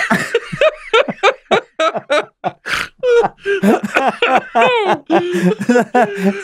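long, helpless high laugh; high burst of laughter. Real, not acted. Man recalling the robbery laughing at his own lunacy.

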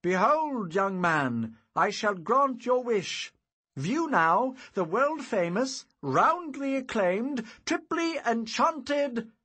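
high, loud, slow showman's flourish; dramatic proclamation. A narrator's reading. Wizard presents his wonder to the boy, dramatic proclamation. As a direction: proclaim it like a grand magician. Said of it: "With dramatic tones, I proclaimed".

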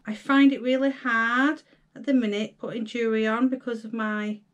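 usual speaking voice

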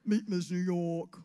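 loud, slow-swelling pageant introduction; slow, projected beauty queen voice; generic pageant queen. Acted. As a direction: present yourself grandly, stretching the state name. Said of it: "I said".

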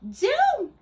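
a high, loud cry of Down; cried out. Acted. Witch shouting to her cat to land. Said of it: "cried the witch".

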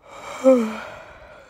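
loud sighing yawn into the mic; big yawn. Real, not acted. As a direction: yawn out loud, long and open. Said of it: "Sorry, let me yawn in your ear".

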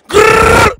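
high, loud rapper growl grrr; demo growl. Shown as a demonstration. Host demoing a rapper ad-lib, loud growl. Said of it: "say with your chest".

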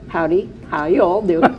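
a light Swedish Oklahoman twang; Swedish Oklahoman accent. Retold or imitated. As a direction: greet like a Swedish Oklahoma exchange student. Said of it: "a Swedish Oklahoman accent".

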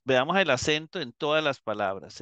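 ordinary talk, nothing marked

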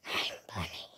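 low breathy zombie voice; hushed monster voice. Pretend. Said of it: "What happened to the zombie voice?".

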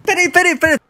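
high, loud, laughing shock; can't believe what he just heard; yelping with surprise; shocked disbelief. Real, not acted.

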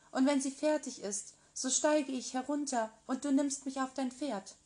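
a shy maiden sweetly asking her prince; the girl in the tower, hopeful and eager; young Rapunzel. Acted. Narrator voices Rapunzel.

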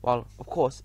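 usual speaking voice

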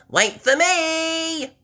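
high, slow, loud call. Acted. Peppa chasing George, calling high and loud. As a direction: call after your brother. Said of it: "calls Peppa".

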